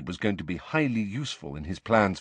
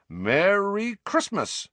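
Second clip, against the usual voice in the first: high, slow, gleeful "merry"; drawn-out festive greeting; 11 semitones higher, much slower than usual. A narrator's reading. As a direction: stretch it out in a high, swooping call. Twin bursts in with holiday greeting.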